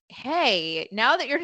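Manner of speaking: normal talking voice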